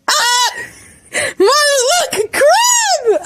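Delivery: put-on shrieking, very high child-like squeal; hammed-up high-pitched scream; high yell. A narrator's reading. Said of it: "Ida yells".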